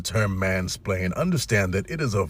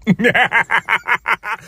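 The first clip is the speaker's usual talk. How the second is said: a high burst of laughter; a real high laugh; 13 semitones higher, 8 dB louder than usual. Real, not acted. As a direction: crack up at the punchline. Laughing at own punchline.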